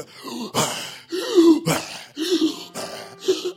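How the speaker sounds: loud, high mock weightlifter gasps; imitated straining gasps. Pastor mimicking guys lifting big weights, exaggerated straining gasps. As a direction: heave out exaggerated breaths of heavy lifting. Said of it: "they, they're going".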